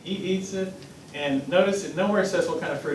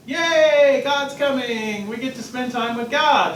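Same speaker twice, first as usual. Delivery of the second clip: put-on higher, cheering child's yay, slowing on God's coming; excited; 5 semitones higher than usual. Adam and Eve before the fall, thrilled at God's coming. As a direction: sound thrilled that God is near. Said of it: "they'd be excited".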